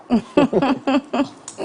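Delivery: high-pitched run of chuckles; amused laugh. Real, not acted. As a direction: laugh after your own slogan. Laughs after the ad slogan.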